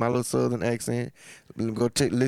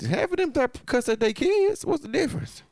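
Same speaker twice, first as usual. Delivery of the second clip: high mock voice; 13 semitones higher than usual. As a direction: squeak it high like a caricature.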